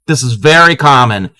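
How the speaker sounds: ordinary talk, nothing marked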